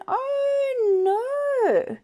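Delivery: high, loud panicked pirate; high cry of a pirate in trouble. Acted. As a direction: squeal it like a falling pirate. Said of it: "And he's going".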